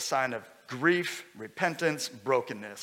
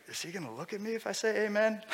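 hushed, quick, higher worried aside; timid churchgoer; 5 semitones higher, 5 dB quieter, faster than usual. Acted. Preacher voicing a shy congregant unsure about saying amen, timid hush. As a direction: ask it softly, as if afraid of being looked at. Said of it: "Some of you are like".

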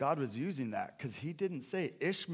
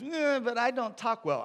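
high, loud whiny excuse; whining Moses; 12 semitones higher, 8 dB louder than usual. Acted. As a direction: whine it like a reluctant servant. Moses making excuses to God.

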